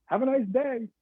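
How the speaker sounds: drive-through server. Acted. Someone handing food out the window, chirpy server voice. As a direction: send it out high like a server at the window.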